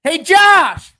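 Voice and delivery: loud, high-pitched holler; yelling across the map. Pretend. Host calling his absent co-host in Florida, distant yell. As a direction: call out to someone very far away. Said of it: "trying to yell across the map".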